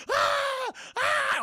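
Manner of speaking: high, loud screams; mock screams; screaming. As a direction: scream as the flames hit. Sarah burning in dream.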